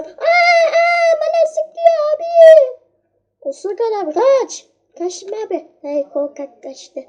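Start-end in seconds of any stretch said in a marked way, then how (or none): 0.0-2.8 much higher, louder slow wail; panicked cry. Real, not acted. Player being shot yelps in panic. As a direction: yelp as the shots hit.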